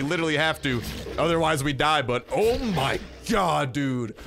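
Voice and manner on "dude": ordinary talk, nothing marked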